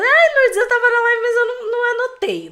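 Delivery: high, whiny mock follower pleading; whiny excuse-maker. Mocking a viewer who missed the live, high whiny excuse.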